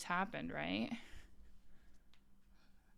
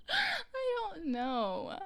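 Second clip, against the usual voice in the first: high, very slow, tearful laughing voice; crying with laughter; 9 semitones higher, much slower than usual. Real, not acted. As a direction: wheeze it out, laughing till it hurts. Still laughing at the story.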